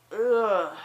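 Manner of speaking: loud, high, fed-up groan; exasperated groan. Retold or imitated. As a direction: groan it out in frustration. Groan at food advice.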